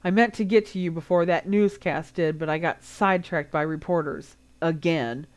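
ordinary delivery, nothing marked

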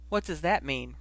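ordinary delivery

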